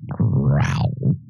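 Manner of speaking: low, rough growled word. Pretend. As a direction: growl it like a monster. Imitates the monster's growl.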